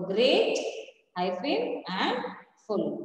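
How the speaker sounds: "great" held apart from "full"; split into syllables. Shown as a demonstration. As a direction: pause after "great", dictating the break before "full". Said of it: "when you split it".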